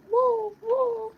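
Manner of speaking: very high, loud squealing woo; high excited woo-woo; squeaky woo. Pretend. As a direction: squeal it out playfully. Playful animal noises.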